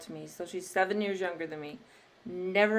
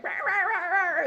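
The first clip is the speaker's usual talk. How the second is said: high mock bickering babble; cartoon quarrel noise, pitched up; mock sibling squabble; 11 semitones higher than usual. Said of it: "and I was like".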